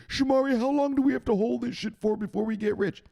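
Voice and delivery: very high, put-on complaint; whining follower. Follower asking how long to hold, whining. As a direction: whine the question in a high cartoon voice. Acted follower asks when riches come.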